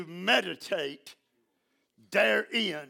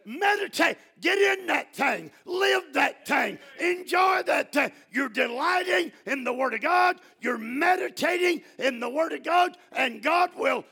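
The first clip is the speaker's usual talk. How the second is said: full-force preaching; 9 semitones higher, 5 dB louder than usual. Acted. Preacher shouting praise to the congregation, loud and high. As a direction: lift the word to a shout.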